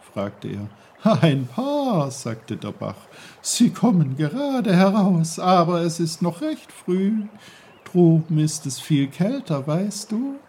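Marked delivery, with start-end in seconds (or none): 1.0-2.1 high, loud, bubbly babbling voice; a merry stream calling out; cheerful brook. Acted. The brook greets Peter loudly and cheerfully. 3.4-10.4 high, loud, bubbly babbling voice; a merry stream calling out; cheerful brook. Acted. The brook greets Peter loudly and cheerfully.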